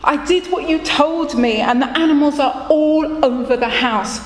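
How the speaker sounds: high, slowed plea for help; poor man begging the rabbi for help, high and pleading. Acted. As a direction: beg the rabbi, near despair. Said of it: "he said".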